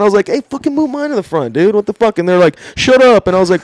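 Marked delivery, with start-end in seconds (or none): 0.3-2.2 indignant demand. Retold or imitated. Demanding cops move his cuffs. 2.8-3.3 cop's order. Retold or imitated. Cop snapping at arrested teller.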